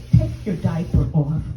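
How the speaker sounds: ordinary delivery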